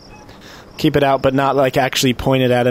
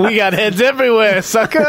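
gleeful taunt; 9 semitones higher than usual. Acted. Gloating at NPC in character.

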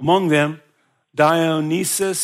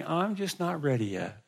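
put-on soft old lady; 3 semitones higher, 7 dB quieter than usual. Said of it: "she would tell me".

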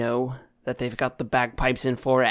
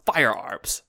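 lightly voiced captain; 6 semitones higher, 5 dB louder than usual. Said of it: "she says".

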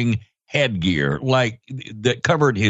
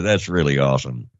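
ordinary delivery

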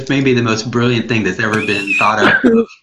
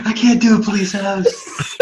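laughing; 6 semitones higher than usual. Real, not acted. Laughing through the bit.

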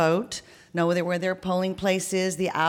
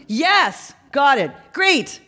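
high, louder, drawn-out reply; calling back; 7 semitones higher, 4 dB louder, much slower than usual. Acted. Canvasser answers man on intercom, calling back. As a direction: call back to someone at a distance.

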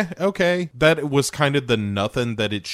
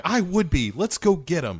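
put-on much higher, dopey, suddenly convinced girl; mock eager Carrie; 7 semitones higher than usual. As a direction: parody a naive girl taking the bait. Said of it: "Carrie is like".

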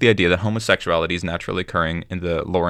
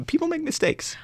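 high mock voice; 7 dB quieter than usual. Mock excuse for old men.

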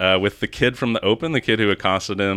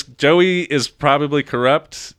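normal talking voice

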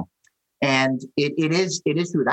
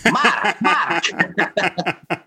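put-on Lithuanian grandma accent; 4 semitones higher than usual. Imitating his Lithuanian grandma urging him, in a Lithuanian accent. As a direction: call his name like a Lithuanian grandmother, laughing. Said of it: "In a good Lithuanian accent".

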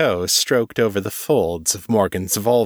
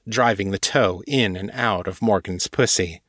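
usual speaking voice

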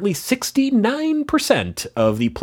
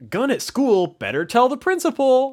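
high, earnest goody-goody; square kid voice; 13 semitones higher than usual. Retold or imitated. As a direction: play the rule-following kid. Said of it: "Are we all squares where we're like".

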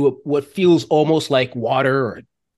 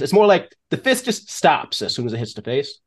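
usual speaking voice

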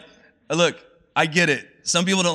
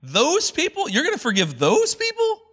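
much higher, outraged whine; indignant Jonah; 12 semitones higher than usual. Retold or imitated. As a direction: protest like a bitter prophet who can't believe it. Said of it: "He's like".

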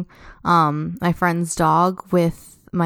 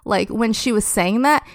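ordinary delivery

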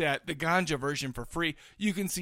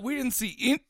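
with hiccups; 6 semitones higher than usual. Real, not acted. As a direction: voice jumping with hiccups. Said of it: "I'm sorry I got the hiccups".

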